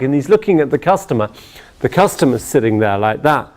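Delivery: usual speaking voice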